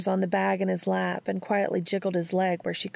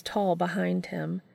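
ordinary delivery

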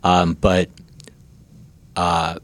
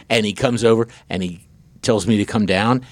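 ordinary talk

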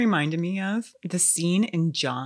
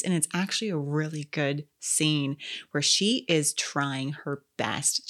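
usual speaking voice

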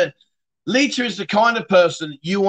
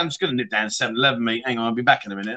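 fast, throwaway, lower and softer, like popping to the shop; mock casual nonchalance; 7 semitones lower, 4 dB quieter, much faster than usual. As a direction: toss it off as if it's nothing at all. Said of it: "he just talks about it like it's me saying".

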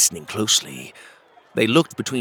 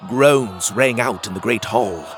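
usual speaking voice

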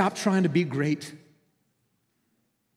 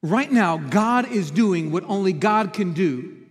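ordinary talk, nothing marked

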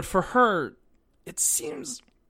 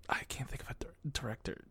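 unvoiced-soft whisper, thinking aloud; under the breath; 14 dB quieter, faster than usual. Real, not acted. Podcaster groping for a director's name, whispered. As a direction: murmur it to yourself while searching for a name.

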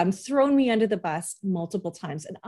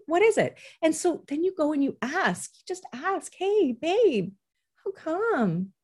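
put-on higher, caring question to the child; gentle parent voice; 7 semitones higher than usual. Parent gently asking an upset kid, light and caring.

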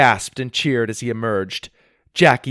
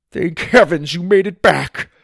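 relieved grandpa; 6 semitones higher, 6 dB louder than usual. Acted. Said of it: "he said with a smile".

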